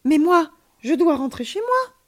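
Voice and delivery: put-on high, loud childlike protest; protesting child. A narrator's reading. Child tells friend of having to go home, protesting.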